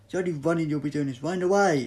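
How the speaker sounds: loud, fast scolding; fast, loud impatient retort; exasperated shout. Acted. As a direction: fire it back at the sprinter. Doctor snaps at sprinter.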